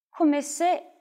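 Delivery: ordinary delivery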